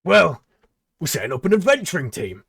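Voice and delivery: loud, high sales pitch; showman pitch. Acted. Tabaxi pitching the job to the goblin, loud showman. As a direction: sell the deal with gusto.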